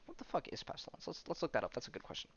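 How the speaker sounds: a private whisper to himself over a strange word; whispered aside. Real, not acted. Recapping a comic, he whispers his confusion about a word. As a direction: breathe it out like a secret note to self.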